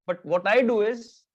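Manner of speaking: ordinary talk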